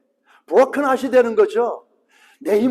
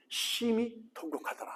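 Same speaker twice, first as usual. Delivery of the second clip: softer, hushed as if grieving; quiet mournful murmur; 15 dB quieter than usual. Acted. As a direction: let it fall soft, near a whisper. Repeats the weeping, much softer.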